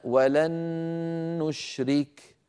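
"ولن نشرك" joined by one long humming n; doubled noon with ghunnah. Shown as a demonstration. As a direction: hold the n of "ولن" right into "نشرك". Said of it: "بالنسبة للنون الساكنة".

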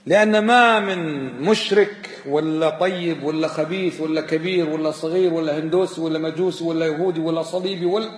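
normal talking voice

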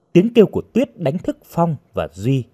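ordinary delivery

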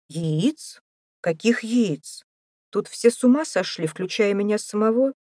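exasperated amazement; bewildered outburst. A narrator's reading. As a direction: rise in pitch as disbelief turns to exasperation. Astonished father's outburst.

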